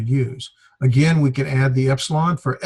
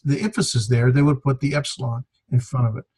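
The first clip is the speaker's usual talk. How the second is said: ordinary talk, nothing marked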